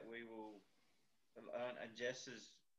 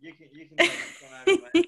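loud, higher voice breaking into laughter; laughing hard; 24 dB louder, faster than usual. Real, not acted. Host handing over to Mel, laughing hard. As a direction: hand over while still cracking up.

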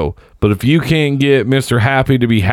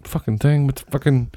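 softer, slightly higher muttering to himself; muttered cursing; 4 semitones higher, 6 dB quieter than usual. Retold or imitated. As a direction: swear under your breath in the tiny plane toilet. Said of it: "And I was like".

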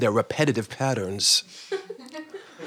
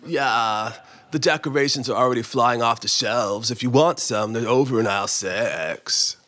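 lower, creakier vocal fry voice; bored creaky-voiced clerk, lower; vocal fry guy, redone; 3 semitones lower than usual. Shown as a demonstration. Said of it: "Vocal fry guy. Is that all right?".

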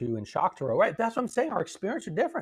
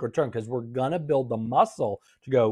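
ordinary delivery

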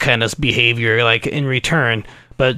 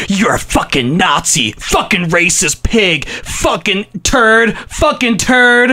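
raw throat-tearing yells; 6 semitones higher, slower than usual. Retold or imitated. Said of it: "be like".

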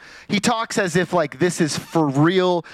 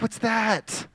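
soft, slightly higher mock puzzlement; mild puzzlement; 5 semitones higher, 4 dB quieter than usual. Preacher voicing someone turning thirty, mildly puzzled. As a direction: lightly question a small new ache. Imitates reaction to aches at thirty.

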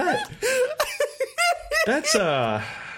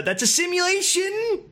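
high, slow pedantic nerd; hammed-up nitpicker, pitched up; doofus nerd; 9 semitones higher, slower than usual. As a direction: pick apart semantics in a high nerd voice. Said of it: "shallow nerd who has to pick apart semantics".

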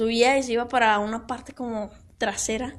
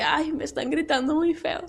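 innocent little voice; 6 dB quieter than usual. Retold or imitated. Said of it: "toda la cara inocente".

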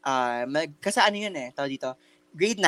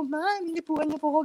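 high-pitched retold complaint; re-enacted protest; 13 semitones higher than usual. Student complains to the teacher about being touched, re-enacted high protest. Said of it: "di sabi ko".

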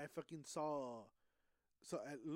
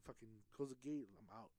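normal talking voice

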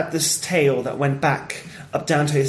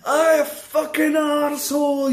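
put-on high, drawn-out Glasgow accent; Billy Connolly; 9 semitones higher, slower than usual. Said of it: "he's got this Glasgow accent".

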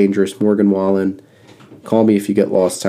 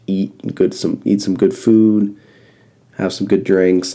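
ordinary talk

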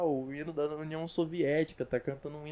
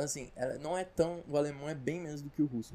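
normal talking voice

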